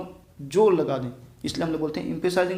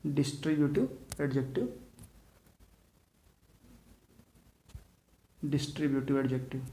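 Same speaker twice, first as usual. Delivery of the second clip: "Distributive adjective" said softer, set apart; dictating the term; 7 dB quieter than usual. Shown as a demonstration. Teacher repeating the term for students to note. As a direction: say the term quietly, let it sink in. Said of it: "जिसको बोलते हैं".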